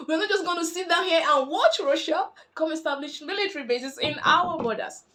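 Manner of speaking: hammed-up outraged shouting; high, loud mock indignation, refusing to stand by; United States. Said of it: "And the United States were like".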